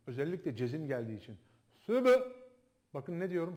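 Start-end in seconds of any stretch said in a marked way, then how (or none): 1.7-2.2 Sübü with the B burst out, raised and loud; popped B. Shown as a demonstration. Teacher demonstrating to students the popped B in Sübü. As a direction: pop the B in Sübü like a small explosion. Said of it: "Sanki yarım bir hareke koyuyormuşum gibi".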